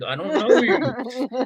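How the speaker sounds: high, slow line broken by laughter; mock denial dissolving into giggles; laughing mid-quote. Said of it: "I was like".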